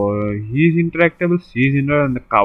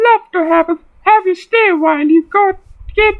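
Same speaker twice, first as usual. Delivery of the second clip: mock high voice. Hammed-up high voice for a woman.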